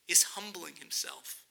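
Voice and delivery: whispered, low and slow, in awe; hushed whisper. Acted. Preacher to congregation, marvelling at Jesus' humility in a whisper. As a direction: breathe it out as a reverent secret. Awed climax of sermon point.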